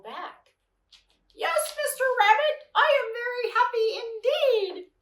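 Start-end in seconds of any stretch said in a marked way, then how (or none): 1.3-4.9 very high, loud squeaky little voice; ridiculous squeaky voice. A narrator's reading. Tiger pretends to be the hole, answering Rabbit in a squeaky voice. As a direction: tiger faking a tiny squeak, badly hiding. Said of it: "such a ridiculous, squeaky little voice".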